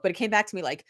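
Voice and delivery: ordinary talk, nothing marked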